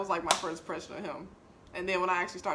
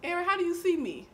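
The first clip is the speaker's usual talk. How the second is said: coaxing question; 8 semitones higher than usual. Retold or imitated. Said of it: "I'll be like".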